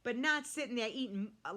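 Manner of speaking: ordinary delivery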